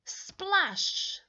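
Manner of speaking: high, loud splash sound word; loud, high splash for the listening kids; big splash. Acted. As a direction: make the splash big and sudden. Falling into the pond.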